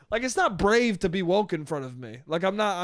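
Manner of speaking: normal talking voice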